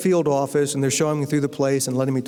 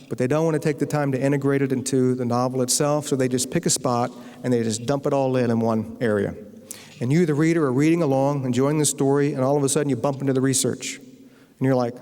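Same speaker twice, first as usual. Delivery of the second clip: usual speaking voice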